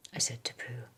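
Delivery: ordinary delivery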